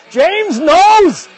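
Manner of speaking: high, loud yell into the mic. Real, not acted. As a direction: belt it out, blowing out the mic. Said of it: "I'm yelling".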